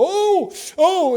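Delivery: high, loud startled oh oh; dismayed waking cry. Acted. A dreamer waking to find the pleasure gone, crying out. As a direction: cry out as the dream slips away.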